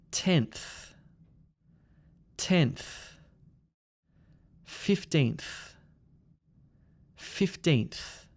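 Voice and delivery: "Seventh", "Ninth", "Tenth", "Fifteenth" ending in n plus breathy th; NTH ending. Shown as a demonstration. Teacher models numbers for repeating, NTH on "Seventh", "Ninth", "Tenth", "Fifteenth". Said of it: "do the N T H sound".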